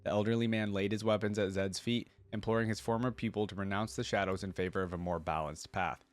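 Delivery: usual speaking voice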